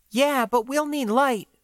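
cautious partner holding him back; Floy's voice. Acted. Floy voiced by the narrator.

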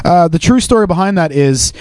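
normal talking voice

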